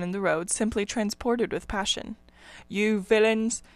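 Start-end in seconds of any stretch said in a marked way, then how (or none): none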